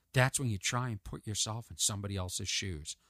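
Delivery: low, soft recited answer; earnest nephew. Retold or imitated. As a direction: imitate a kid explaining quietly.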